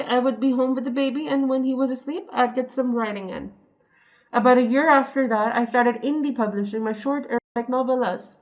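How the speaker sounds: ordinary talk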